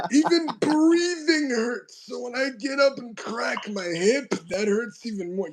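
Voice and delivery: put-on very high, slow old man whine. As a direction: complain like a frail old man. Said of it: "concerning impression of an old man voice".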